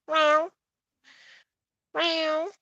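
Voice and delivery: high imitated meow at a cat. Real, not acted. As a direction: meow gently to the kitty. Meowing at the cat.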